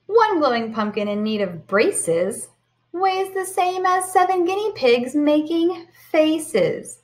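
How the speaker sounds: lively storybook read-aloud; sing-song picture book voice; reading to children. Acted. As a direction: read it bright and animated for the kids.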